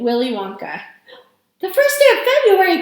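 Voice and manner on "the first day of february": high, loud cry of dismay; raised, urgent exclamation; alarmed cry. A narrator's reading. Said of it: "cried Missus Bucket".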